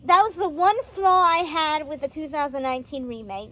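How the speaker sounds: ordinary talk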